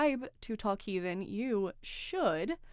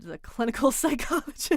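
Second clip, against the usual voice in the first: real laugh bursting through the last word; breaking into laughter; 4 semitones higher, 5 dB louder than usual. Real, not acted. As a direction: try to say it straight, then crack up. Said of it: "can't even keep a straight face".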